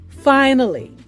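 usual speaking voice

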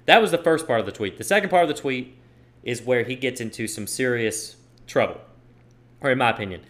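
ordinary delivery

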